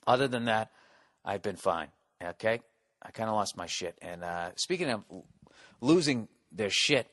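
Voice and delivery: ordinary delivery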